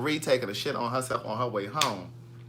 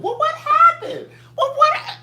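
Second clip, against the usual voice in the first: much higher, slow squeaky mock question with a laugh; mocking viewers; 7 dB louder than usual. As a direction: laugh while mimicking the clueless viewers. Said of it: "that's how they talk".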